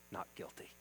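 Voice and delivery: breathy whisper, barely there; hushed verdict. Acted. The holy judge whispering the verdict to the sinner. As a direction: whisper it like a verdict you can't believe.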